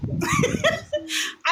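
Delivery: collapse into silent giggles; airy high laugh. Real, not acted. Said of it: "why I'm laughing".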